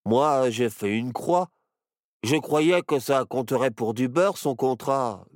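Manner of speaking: mildly grumpy bear cub; grumbling. A narrator's reading. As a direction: grumble a sheepish excuse. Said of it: "bougonna Barnabé".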